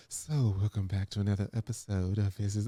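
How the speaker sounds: low, soft parody of a podcast host; mock host voice. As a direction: imitate her switching into presenter mode. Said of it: "switch her voice over to this".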